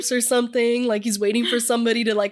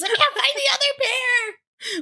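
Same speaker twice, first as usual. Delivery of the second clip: high, slow, hammed-up whine; pretending to be a panicked shoe-hunter; mock panic; slower than usual. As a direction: wail like a flustered searcher. Acting frantic household.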